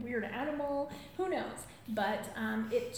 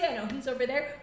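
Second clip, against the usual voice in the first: ordinary talk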